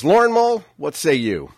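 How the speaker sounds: loud, high showy handoff to co-host; big announcer callout. Real, not acted. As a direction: call the name out like a radio host.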